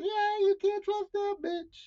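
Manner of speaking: high, slow, soft distrust; sly, drawn-out told-you-so; knowing suspicion. Retold or imitated. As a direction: draw it out high and quiet, suspicious. Acts out own suspicion of girlfriend.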